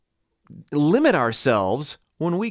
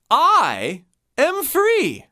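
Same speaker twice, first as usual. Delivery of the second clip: more energized; 8 semitones higher, 3 dB louder, much slower than usual. Shown as a demonstration. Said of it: "a few times".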